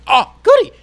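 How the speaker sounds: shrieked high and loud, overjoyed; mock delight. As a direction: squeal like a kid getting candy. Retelling his fake delight.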